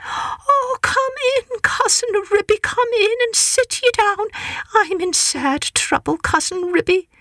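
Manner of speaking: high, fretful mother cat near tears; mother cat greeting her cousin, high and tearful. Acted. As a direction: plead like a worried mother cat. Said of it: "said Tabitha, shedding tears".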